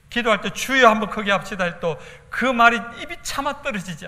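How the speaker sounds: loud, high fervent preaching. Real, not acted. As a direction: drive the point home with force. 설교 절정의 힘찬 외침. (힘차게 외치는 설교)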